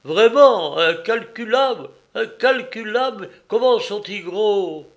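high, loud, eager questioning; excited amazement. Acted. Starving man hears of countless fish, excited amazement. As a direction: marvel out loud, hungry for details.

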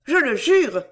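high, loud oath; energetic cry. A narrator's reading. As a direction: swear it with all your energy. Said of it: "s'écria-t-elle avec énergie".

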